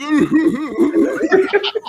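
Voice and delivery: high, loud wailing whimper; mock whimpering. Storyteller imitates what he heard in the casino, mock whimpering. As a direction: whimper loudly in imitation of a crying man. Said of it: "I hear this whimpering".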